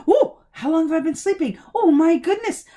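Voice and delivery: loud, high-pitched startled outburst; startled awake. A narrator's reading. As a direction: jolt out of sleep in alarm. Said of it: "he woke with a start".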